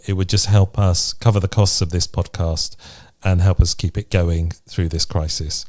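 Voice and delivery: ordinary talk, nothing marked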